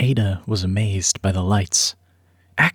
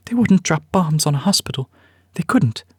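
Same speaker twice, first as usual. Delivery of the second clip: higher woman's voice, pleading with herself; frightened pregnant aunt, higher voice; aunt's anxious denial; 9 semitones higher than usual. Acted. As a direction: insist it can't happen, scared and higher. Aunt denies bombing, frightened.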